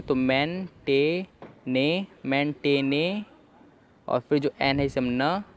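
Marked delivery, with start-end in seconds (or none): 0.1-3.2 मैन टे ने sounded out slowly in parts; syllable by syllable. Shown as a demonstration. Teacher sounding out मैन टे ने for students. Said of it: "इसे हम पढ़ रहे हैं ए".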